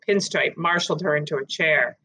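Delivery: usual speaking voice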